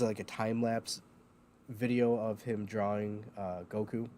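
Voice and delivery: ordinary talk, nothing marked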